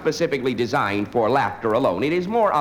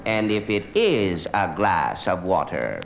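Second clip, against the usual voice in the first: slow, lower, doubting "But is it a glass of water?" with weight on "is"; hammed-up philosophical musing on "is it a glass of water"; mock pondering student; 6 semitones lower, much slower than usual. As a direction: drag out "But is it a glass of water?" like a pretentious student questioning the obvious. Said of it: "he says".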